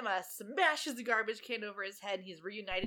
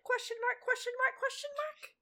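each question mark higher; incredulous question marks, climbing; rising mock confusion; 3 dB louder than usual. Real, not acted. Mock puzzlement.

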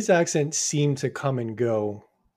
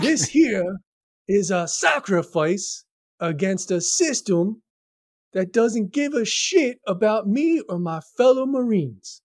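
mock Southern drawl, pitched higher and slowed; an imitation of a movie monologue in a Southern accent, higher and slow; 7 semitones higher, slower than usual. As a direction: ham up Cage's Southern Marine speech, higher and slow. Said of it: "I'm gonna try and do it".

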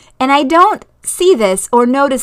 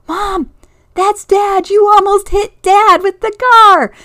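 put-on much higher child voice, rising to loud; alarmed kid; 11 semitones higher, 7 dB louder, slower than usual. Kid warns Mom she almost hit Dad, high alarmed shout. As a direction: imitate a panicked kid crying out to Mom. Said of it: "one of the kids is like".

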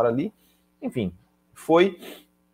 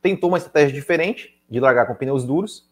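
normal talking voice

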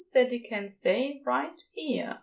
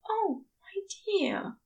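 hushed angel; 10 semitones higher than usual. Acted. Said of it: "he whispers".

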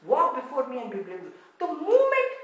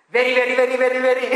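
"Very, very, very" in a light Indian accent, louder; 8 dB louder, much faster than usual. Shown as a demonstration. Preacher showing Hebrew repetition in a light Indian accent. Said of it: "I know that's an Indian accent".

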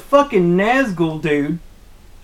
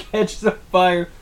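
usual speaking voice

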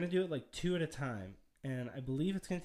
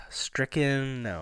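normal talking voice

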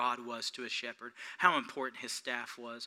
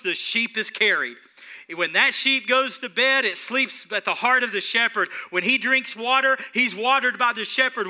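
louder, higher rolling preaching; preaching at full force; 10 semitones higher, 10 dB louder than usual. Acted. Preacher telling the congregation how God carries them, full-force preaching. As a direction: ride the climax with rising fervour. Climax of shepherd sermon.

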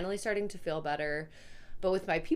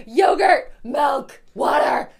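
high, loud whining list; mother imitating her toddler demanding snacks, high and loud; whiny toddler demands; 7 semitones higher, 14 dB louder, much slower than usual.

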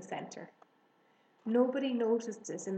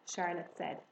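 plain reading voice

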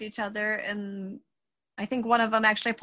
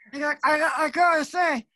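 ninja pup voice; 5 semitones higher, 3 dB louder than usual. Acted.

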